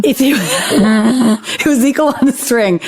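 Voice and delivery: slow, laughing through the title; giggling confirmation; amused, drawn-out laughing reply. Real, not acted.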